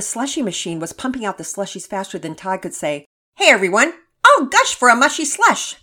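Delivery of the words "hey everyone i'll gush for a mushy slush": put-on high, loud goofy boy shout; a silly kid announcing to everyone; kid calling out. Todd calling out at party.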